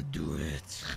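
very low, soft drawn-out growl; Undertaker promo. Retold or imitated. Player asking why Wesker talks like that, mock menacing. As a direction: imitate an overblown menacing wrestler promo. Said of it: "an Undertaker promo or something like that".

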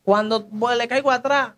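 ordinary delivery

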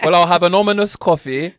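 mock-triumphant order, punched out; defiant comeback. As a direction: show off the fancy word loudly. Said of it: "hit 'em with your".

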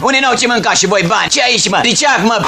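high barked orders; raid shout. Acted. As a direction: push them around hard. Commissar bullies during raid.

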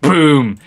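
a quick playful mouth-made boom; mouth explosion sound. Pretend. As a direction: blurt a cartoon explosion with your mouth. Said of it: "explosion sound".

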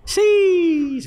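high, loud hyped exclamation; excited sheesh. Real, not acted. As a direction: hype it up like a big win. Hyping up the prize.

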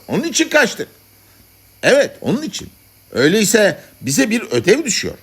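high-pitched, emphatic reasoning; congress speaker. Acted. Madmen debating why they escaped, speaking up emphatically. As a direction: argue the point to the assembly.

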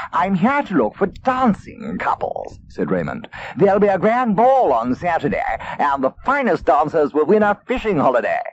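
a smooth gentleman fox; polished fox greeting; suave fox. Acted.

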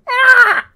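sharp high-pitched yelp. Real, not acted. Watcher reacting to someone getting bullied, high yelp. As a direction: let out a loud, high "ah".

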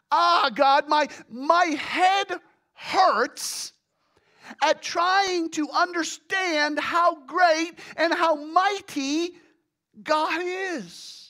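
loud, high, slow cry to God; pained, straining prophet's lament; overwhelmed Jeremiah. Acted. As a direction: labor over each phrase as if the head aches. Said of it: "almost as if Jeremiah is saying".

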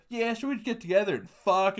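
high, casual bro voice; offhand high-pitched bro confession; weird guy impression. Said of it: "this guy is out of nowhere".

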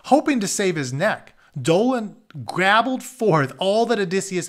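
storyteller reading a chapter aloud; reading aloud an Iliad retelling; story narration. A narrator's reading. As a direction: read the tale to the class, steady and clear.

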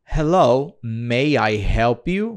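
repetindo bem devagar o "Hello, may I help you?"; repetição lenta. Shown as a demonstration. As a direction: imitar o áudio devagar, sílaba por sílaba. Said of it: "Estou ouvindo o inglês americano". (repetição lenta do áudio)

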